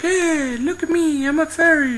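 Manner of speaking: very high, squeaky little fairy voice; nice fairy. Acted. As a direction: chirp a friendly greeting like a tiny fairy. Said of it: "fairies are all nice and they come out to greet you".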